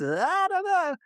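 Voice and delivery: high-pitched, noncommittal shrug; vocal shoulder shrug. Acted. Host hedging great news with caveats, shrugging it off. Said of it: "with the caveats and shoulder shrugs".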